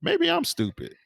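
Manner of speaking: high, squeaky self-mocking question; a comic high voice musing he might be the stupid one; mock self-doubt. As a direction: pitch way up as if doubting yourself. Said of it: "I talk about these moments sometimes where I feel like".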